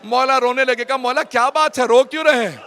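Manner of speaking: high, loud grief-laden cry; loud lament for a lost son; loud wailing recitation. Real, not acted. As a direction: cry out the story in mourning. Mourning recitation climax.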